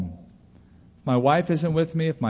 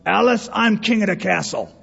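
higher-pitched imitation of a sitcom husband proclaiming to his wife; mock domestic bluster, pitched up; 5 semitones higher than usual. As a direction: put on a boastful sitcom husband voice. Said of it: "he would say to his wife".

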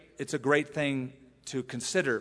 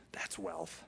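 whispered; 8 semitones lower, 13 dB quieter than usual. Shown as a demonstration. Preacher hushing the congregation, whispered awe. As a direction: whisper "That's wealth." in awe.

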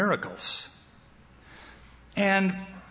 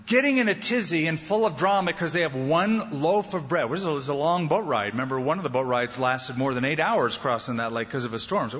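ordinary talk, nothing marked

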